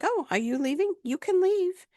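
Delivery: mocking dismissal. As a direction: wave her off in a high mock lilt.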